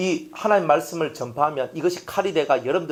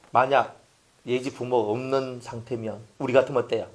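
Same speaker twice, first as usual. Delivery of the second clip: normal talking voice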